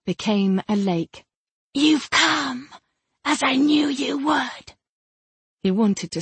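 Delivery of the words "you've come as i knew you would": high, distant voice of a spirit; higher otherworldly spirit voice. Acted. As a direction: speak like a spirit bound in a gem. Spirit Rumayesh speaks to Brahma.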